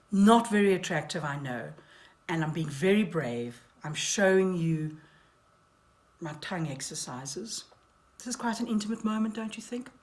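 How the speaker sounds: ordinary talk, nothing marked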